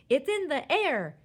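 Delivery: high, loud astonished cry; flabbergasted father's yell; amazed shout. A narrator's reading. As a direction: exclaim it in disbelief.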